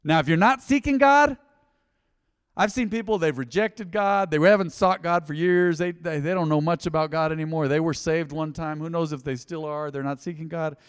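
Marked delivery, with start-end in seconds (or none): 0.0-8.8 raised, driving pulpit delivery; forceful preaching. Real, not acted. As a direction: press the warning on the congregation.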